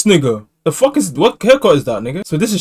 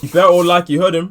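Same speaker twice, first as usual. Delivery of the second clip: usual speaking voice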